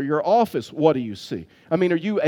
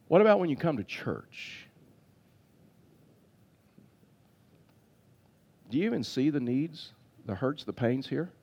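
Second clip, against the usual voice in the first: intimate preaching; 3 semitones lower, 6 dB quieter than usual. Acted. Pastor gently challenging his church, softer. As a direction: draw the church in quietly.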